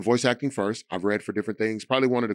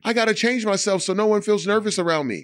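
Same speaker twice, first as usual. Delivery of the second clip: high-pitched, anxious imitation of himself; nervous younger self; 11 semitones higher, 4 dB louder than usual. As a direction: play up an insecure youth, voice pitched way up. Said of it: "would be nervous and be like".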